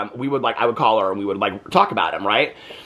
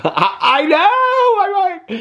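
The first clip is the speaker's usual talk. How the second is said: 1 laughing squeal; 4 dB louder, much slower than usual. Real, not acted. Reacting to her own joke.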